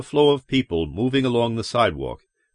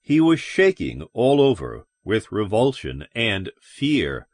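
usual speaking voice

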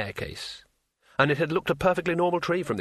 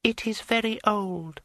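smooth dryad voice; 9 semitones higher than usual. A narrator's reading. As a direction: glide through it smoothly. Dryad speaks.

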